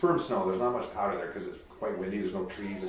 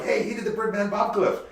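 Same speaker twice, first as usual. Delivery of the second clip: bar patron quote; 11 semitones higher, 3 dB louder than usual. Retold or imitated. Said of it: "and then in the bar, they're like".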